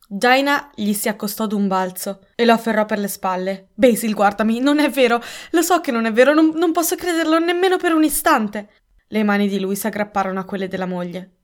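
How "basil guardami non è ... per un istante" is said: raised, loud, urgent plea; wife frantically insisting he is innocent; desperate pleading. A narrator's reading. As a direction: plead with mounting pitch. Wife pleads with accused husband.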